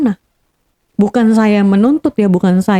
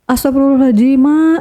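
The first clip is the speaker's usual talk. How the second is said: higher-pitched mock yell, no real volume; retold cry out; 5 semitones higher than usual. Daughter finding grandmother's eyes rolled up, mildly imitated cry out. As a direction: cry out to Mom in a lightly raised retold voice. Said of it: "saya udah teriak kan".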